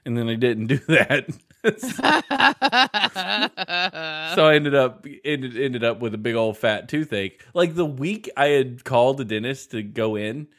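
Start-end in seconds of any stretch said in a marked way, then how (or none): 1.6-4.3 high, long burst of laughter; big laugh. Real, not acted. Laughing at her own story.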